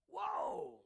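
high, stunned whoa. Real, not acted. Preacher reacting to God's words, astonished high whoa. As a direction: gasp it out in amazement. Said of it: "I'm like".

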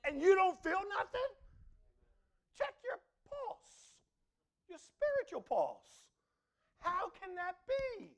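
almost shouting the challenge; challenging. Real, not acted.

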